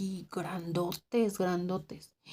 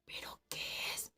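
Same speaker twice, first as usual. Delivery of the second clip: frightened whispered question; 16 dB quieter than usual. Retold or imitated. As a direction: ask it under your breath, scared. Scared girl whispering back.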